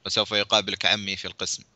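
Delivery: plain reading voice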